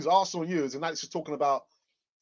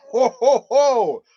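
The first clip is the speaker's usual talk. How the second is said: loud ho ho ho laugh; belly laugh, loud and high; 7 semitones higher, 12 dB louder, much slower than usual. Real, not acted. Laughing at own memory.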